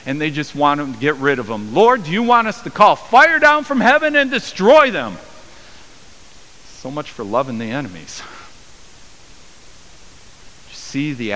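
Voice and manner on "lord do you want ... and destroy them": high and loud, itching for a fight; indignant outburst. Acted. Disciples to Jesus about the Samaritans, a high loud indignant outburst. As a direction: demand fire from heaven, worked up.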